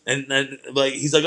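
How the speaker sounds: ordinary talk, nothing marked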